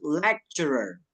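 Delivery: "lecturer" slowly as LEK-cher-er; English pronunciation. Shown as a demonstration. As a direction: model "lecturer" syllable by syllable, C as K. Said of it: "it should be pronounced". ("lecturer" pelan, dibaca lek-cer-er)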